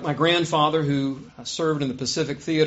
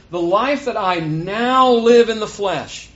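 "now" stretched out, loud and high; slow the verse and hit "now" hard; stress on now; 5 semitones higher, 6 dB louder, slower than usual. Shown as a demonstration. Preacher correcting "just life lived", heavy stress on "now". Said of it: "I'm emphasizing that word now".

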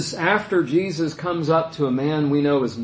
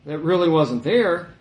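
ordinary talk, nothing marked

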